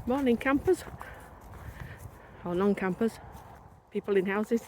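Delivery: muffled by a scarf over the mouth; through a scarf. Real, not acted. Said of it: "I've got a scarf across my gob".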